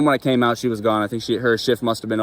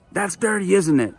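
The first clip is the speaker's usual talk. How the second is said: mock scolding; 7 semitones higher than usual. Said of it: "Like, yelled".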